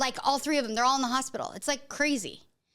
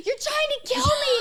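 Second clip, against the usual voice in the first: high, loud mock shriek; imitated screaming; 13 semitones higher, 5 dB louder than usual. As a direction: cry out like a panicked child. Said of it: "he starts screaming".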